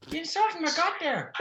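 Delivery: high-pitched Irish nun; indignant nun in a high Irish accent; Irish woman's accent. Acted. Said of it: "I can do the Irish woman's accent".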